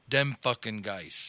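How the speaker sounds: low, slow comic impression; imitating a grumbling comic's opener; Russ Rivas. Shown as a demonstration. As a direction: drawl it out low with a thick "dem". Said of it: "Russ Rivas actually, uh, has to start".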